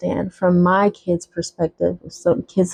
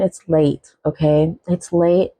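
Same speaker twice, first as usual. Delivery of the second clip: ordinary talk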